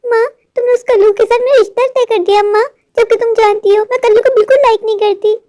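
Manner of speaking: put-on high girlish voice, hurt; a spoiled daughter let down by her mother; whiny daughter. Daughter Gudiya complains.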